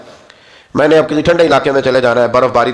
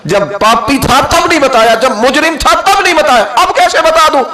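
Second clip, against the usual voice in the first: higher and louder with each تب نہیں بتایا; building divine declaration; 12 semitones higher, 4 dB louder than usual. Acted. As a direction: climb with every repeat to a peak. God's reply, rising in force. (ہر بار بلند ہوتی الٰہی آواز)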